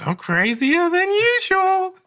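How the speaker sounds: slow, high and loud falsetto. Shown as a demonstration. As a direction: stretch it high and slow like a madman. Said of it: "not be afraid to go into the falsetto".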